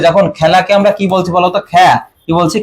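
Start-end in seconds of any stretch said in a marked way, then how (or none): 1.7-1.9 খ্যা with a wide open "ae"; short demonstrated খ্যা, vowel opened to ae. Shown as a demonstration. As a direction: isolate the syllable খ্যা as "khae". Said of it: "এর উচ্চারণ যখন এ এর মতো হবে".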